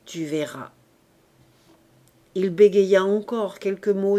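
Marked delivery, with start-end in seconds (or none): 0.0-0.7 plus bas et plus doux, solennel; voix grave. A narrator's reading. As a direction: une menace calme et sombre. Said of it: "répliqua d'une voix grave". (Grantaire répond d'une voix grave)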